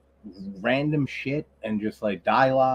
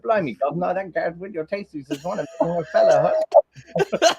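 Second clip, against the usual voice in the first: mock British accent, high and rising into laughter; Gareth; 6 semitones higher than usual. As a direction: imitate an over-the-top Cockney dub. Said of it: "put his voice over".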